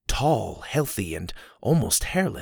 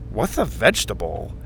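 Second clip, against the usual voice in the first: sarcastic. A narrator's reading. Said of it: "Max said sarcastically".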